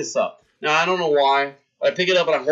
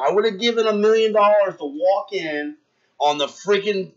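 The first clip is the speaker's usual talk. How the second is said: usual speaking voice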